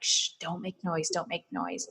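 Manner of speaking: soft hushed plea; shushing so no one hears; quietly urging don't make noise; hushing myself. Retold or imitated. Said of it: "my body's crying, but I'm like".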